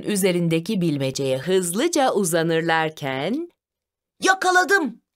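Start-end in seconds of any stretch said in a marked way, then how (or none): 4.2-5.0 triumphant shout. A narrator's reading. Brother grabs the riddle first, high mild shout. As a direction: cry out on a raised pitch. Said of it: "diye bağırdı Bartu".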